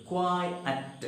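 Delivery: "Quiet" broken softly into two beats; split into two syllables. Shown as a demonstration. Teacher showing students two syllables in that word, "Quiet" split in two. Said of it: "two syllables are there in that word".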